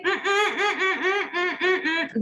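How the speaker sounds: high, soft humming bursts; closed-mouth mumbling. Acted. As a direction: try to talk with the mouth shut. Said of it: "because mouth is shut".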